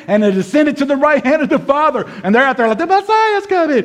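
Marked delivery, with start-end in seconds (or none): 2.8-3.8 very high, put-on herald's cry; mock proclaiming. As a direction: parody a clueless street preacher, pitched way up. Mimicking followers' out-of-date cry.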